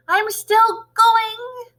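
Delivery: high, loud, very slow screech; screechy old voice. Acted. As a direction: screech it down from the attic. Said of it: "the old screechy voice".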